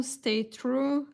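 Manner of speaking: ordinary delivery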